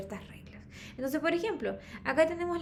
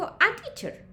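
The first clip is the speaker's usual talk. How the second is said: A punched high and loud before teacher; stress on A; 8 semitones higher, 5 dB louder, slower than usual. Shown as a demonstration. Teacher contrasting a with an, stress on A. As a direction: hit the article A. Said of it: "porque empieza con T".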